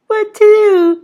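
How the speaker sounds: very high, loud squeak. A narrator's reading. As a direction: squeak in alarm. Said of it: "squeaked Polly".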